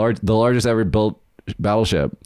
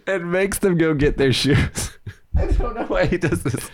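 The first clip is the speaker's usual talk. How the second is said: high voice breaking into laughter; laughing; 9 semitones higher than usual. Real, not acted. Friend throws girls' shoes outside, told laughing. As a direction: crack up helplessly while finishing the story. Cracking up at friend's shoe prank.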